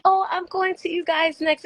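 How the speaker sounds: high, bright, cute imitation of a cheerful maid; high-pitched imitation of a cheerful hotel maid; sweet cleaning lady. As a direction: play the friendly housekeeper sweetly. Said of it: "And she was just like".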